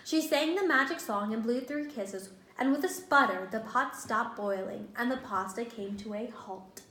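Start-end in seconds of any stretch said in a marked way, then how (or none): none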